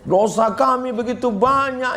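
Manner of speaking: high-pitched imitation of a prayer leader; retold muhasabah plea. Preacher retelling his attempt to make the congregation cry, high plea. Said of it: "muhasabah lima menit".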